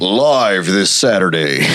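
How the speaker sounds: slow, deep hype announcer; fighting game announcer. Pretend. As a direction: drag it out like a ring MC. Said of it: "I'll M C a show".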